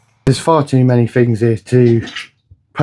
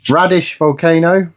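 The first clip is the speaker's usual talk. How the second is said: usual speaking voice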